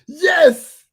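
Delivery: a high, loud, almost crying "Yes."; loud, incredulous confirmation; emphatic yes. Real, not acted. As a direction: insist it really happened. Confirms the shocking news.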